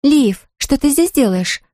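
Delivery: a startled gasp of a question. A narrator's reading. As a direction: caught off guard, gasping at Liv. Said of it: "ахнула Люси".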